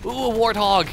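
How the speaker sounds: sudden glee at a target; excited spotting. Real, not acted. Spots a vehicle to attack.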